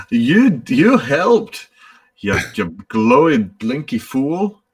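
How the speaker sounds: high and loud mock scolding; fond scolding. Woman fondly scolding the glowing helper, high and loud. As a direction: tease the fool with fond exasperation.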